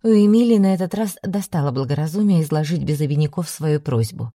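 ordinary delivery

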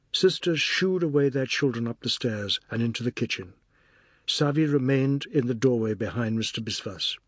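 ordinary delivery

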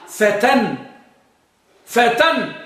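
فتى said "fatan", ending in a clear n; with tanween. Shown as a demonstration. Teacher gives students the resulting word, فتى with tanween "-tan". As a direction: sound the final n firmly on each فتى. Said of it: "فالأصل أن تكون فتى بالتنوين".

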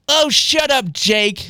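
high, irritated put-down; exasperated. A narrator's reading. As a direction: cut him off in exasperation. Jinx snaps at Jake.

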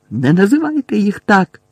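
high, loud angry protest; furious. A narrator's reading. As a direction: cut in hotly to stop her. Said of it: "розлючено сказала Герміона".

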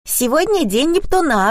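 put-on high-pitched little girl's announcement; child's sing-out voice; Kira announcing. A narrator's reading. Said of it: "объявила Кира".

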